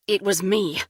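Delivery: loud sudden confession; blurted out. Acted. Lover confessing he made the videos, blurting loudly. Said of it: "Kyle blurted".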